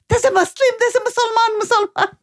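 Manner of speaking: high-pitched mock scream, voicing an excited little girl; excited child's cry. Mother retelling her three-year-old daughter spotting a hijab, excited high-pitched cry. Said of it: "She screamed out".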